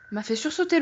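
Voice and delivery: ordinary delivery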